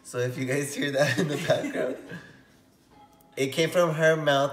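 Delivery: loud, laughing aside to viewers. Real, not acted. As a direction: keep laughing while explaining. Still laughing after the joke.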